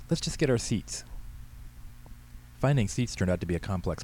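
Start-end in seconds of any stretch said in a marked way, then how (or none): 0.1-1.0 high, hushed urgent hiss; embarrassed teen's higher-pitched hiss. A narrator's reading. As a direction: hiss it at Dad to move along. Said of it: "Katie hissed".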